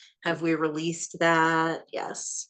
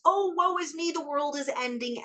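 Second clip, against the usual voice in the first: much higher, hammed-up lament; melodramatic woe; 10 semitones higher than usual. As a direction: wail it like a tragic heroine.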